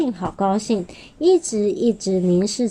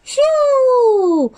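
high whistling whoosh; whoosh sound; 5 dB louder than usual. A narrator's reading. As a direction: whistle it like a flying stone. Stone whizzing sound effect.

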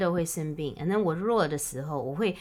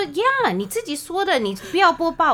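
higher, louder insistent retort; mock insistence; 9 semitones higher, 5 dB louder than usual. Woman voices partner insisting during a boba quarrel, high and pushy. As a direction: insist like you are sure you're right.

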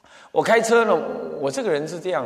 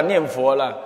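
normal talking voice

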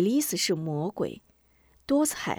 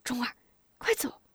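softer, urgent mother's voice; hushed urging; 4 semitones higher, 6 dB quieter than usual. Acted. Mother pulls her son into shadows, hushed urgency. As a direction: urge him away without being overheard.

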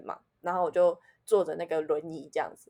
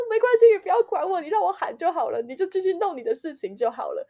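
higher, louder, fading as it goes; retold pained yell; 10 semitones higher, 4 dB louder than usual. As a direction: replay telling the medics to let you shout. Retelling yelling at medics in pain. (回忆里忍痛冲医护人员喊，语调高、声音大)

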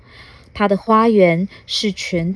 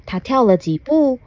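usual speaking voice

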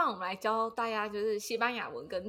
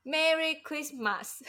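slow, heavy Japanese-style Merry Christmas; Merry Christmas 的马斯发得很重; Japanese-accented English; 6 semitones higher, much slower than usual. Retold or imitated. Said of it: "马斯就发的音发的很重". (日本腔的英文)

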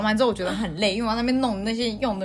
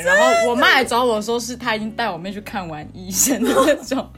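usual speaking voice

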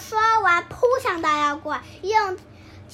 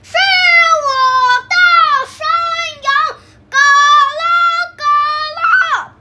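much higher, louder, one syllable at a time; raised voice; 9 semitones higher, 10 dB louder, faster than usual. A narrator's reading. Big goat answers the troll in a raised voice. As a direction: bellow each word, spaced out. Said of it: "大山羊拉高嗓门说道".

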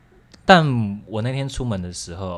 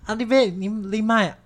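high protest; 13 semitones higher, 3 dB louder than usual. Retold or imitated. Protesting to grandma.

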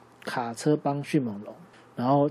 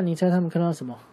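plain reading voice

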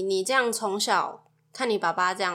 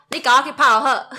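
loud, higher-pitched mock command; mock bossy order; 4 semitones higher, 10 dB louder than usual. Wife mocking a bossy husband, loud mock order. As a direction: blurt a bossy order, much louder.

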